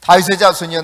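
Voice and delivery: loud, forceful read-aloud plea. Acted. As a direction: project the verse strongly. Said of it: "크게 소리질러 이르되".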